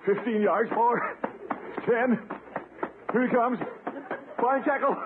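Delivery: high, slow excited count-down; breathless running commentary. Acted. Gildersleeve charging for the goal line, high excited commentary. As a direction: narrate your own run as you charge. Running play-by-play of own run.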